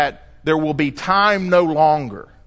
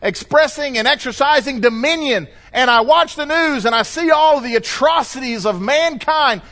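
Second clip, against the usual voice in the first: ordinary talk, nothing marked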